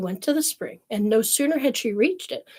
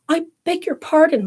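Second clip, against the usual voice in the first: a hint of a timid young girl, raised high; polite girl; 8 semitones higher, 5 dB louder than usual. Poor child apologising to her scolding mother, higher voice.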